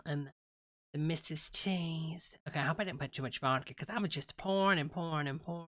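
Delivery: ordinary talk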